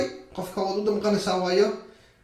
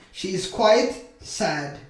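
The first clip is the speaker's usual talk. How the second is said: very slow, drawn-out words; slow modelling; slower than usual. Shown as a demonstration. Teacher models a sentence for beginners, very slowly. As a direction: stretch each word for learners.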